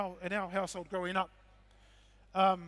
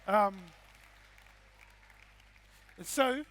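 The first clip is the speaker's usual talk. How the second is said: ordinary talk, nothing marked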